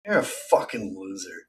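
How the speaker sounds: voiceless, strained whisper; exasperated whisper. Real, not acted. Fed up with his rival, in a strained whisper. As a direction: breathe it out in disgust.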